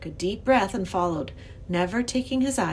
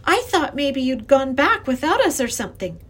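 lightly voiced girl, higher and louder; relieved young girl; 6 semitones higher, 6 dB louder than usual. A narrator's reading. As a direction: blurt out relief to the professor. Relieved girl greets lost professor.

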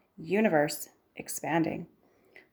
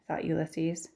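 plain reading voice

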